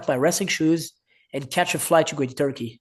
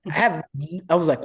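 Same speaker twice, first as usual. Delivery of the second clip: ordinary talk, nothing marked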